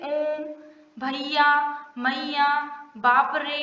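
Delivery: ओम, भैया, मैया, बाप रे with the vowels held long; teacher leading the class in stretched ओम, भैया, मैया; drawn-out vowels. Shown as a demonstration. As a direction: drag each vowel out for the class to repeat. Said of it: "इन word को थोड़ा खींच के बोला जाता है".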